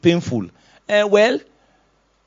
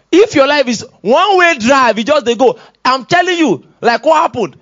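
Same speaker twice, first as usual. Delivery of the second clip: raised, high-pitched preacher's urgency; impassioned pulpit warning; forceful preaching; 7 semitones higher, 5 dB louder than usual. Real, not acted. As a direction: drive it at the congregation.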